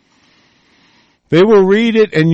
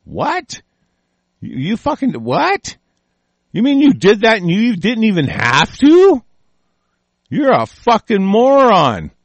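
high, outraged what then a slow insult; incredulous disbelief; 8 semitones higher than usual. Retold or imitated. Retelling his reaction to followers, high stunned outrage. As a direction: act floored by sheer stupidity. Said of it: "I was just".

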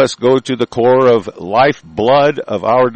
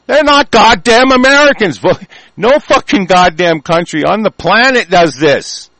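sudden much higher, louder yell; furious shout; 10 semitones higher, 7 dB louder than usual. Real, not acted. Ranting host erupts at lawmakers, furious shout. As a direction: blow up and shout it out. Angry outburst at immigrant voting.

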